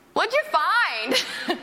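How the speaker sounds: high, long-drawn "find"; playful high sing-out question; imitated call-out. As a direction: call over like a curious stranger, drawing it out. Couple calling out to her from hammock.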